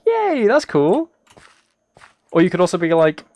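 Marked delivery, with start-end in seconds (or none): 0.1-1.1 high, slow, drawn-out cheer; mock excited yay. Streamer imagines a viewer announcing a trip, mock delighted cheer. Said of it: "and I'd be like".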